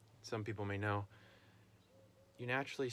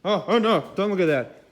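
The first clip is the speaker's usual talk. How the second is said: loud, high mock alarm; mock dismay; 9 semitones higher, 17 dB louder than usual. Real, not acted. Farmer loudly and highly mock-alarmed at his messy shop. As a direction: cry out in playful embarrassment.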